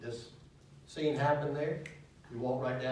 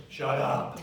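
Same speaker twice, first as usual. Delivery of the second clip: blunt command; 6 dB louder than usual. Retold or imitated. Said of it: "guess what Jesus says?".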